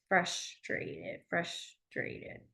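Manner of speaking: unvoiced soft whisper; whispered demo. Shown as a demonstration. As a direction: breathe it out. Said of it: "bumping into your face".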